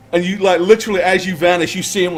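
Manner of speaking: ordinary talk, nothing marked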